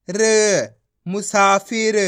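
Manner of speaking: ordinary talk, nothing marked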